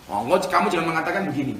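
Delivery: usual speaking voice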